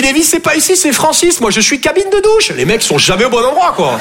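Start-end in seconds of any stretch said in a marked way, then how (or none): 0.0-2.5 much higher, dismissive clerk voice; offhand store clerk. Retold or imitated. Clerk sending the customer to Francis, high dismissive voice. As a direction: brush him off, not my department. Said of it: "Il me dit".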